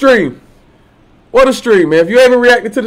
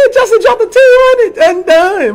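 hype shout-out; 9 semitones higher, slower than usual. Real, not acted. Hyping a big donation.

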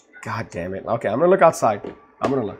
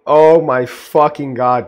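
slow, much louder drawn-out cry of disbelief; stunned disbelief; 2 semitones higher, 11 dB louder, slower than usual. Real, not acted. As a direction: stretch every word in awe. Still stunned.

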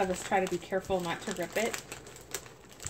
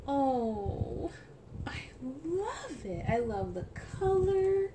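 delighted; 6 semitones higher, slower than usual. Real, not acted. Delight at the outfit.

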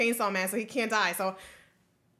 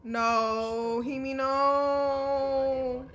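drawn-out lament; 2 semitones higher, much slower than usual. Real, not acted. Lamenting a character.

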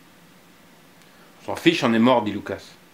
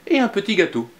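higher, lightly voiced little boy; child voice; 8 semitones higher than usual. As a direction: play Anton offering treats sweetly. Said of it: "dit Anton".

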